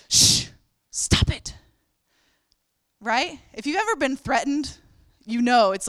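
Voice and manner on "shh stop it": shushing. Retold or imitated. Crowd shushing the beggar, hissed and sharp. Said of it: "they're like".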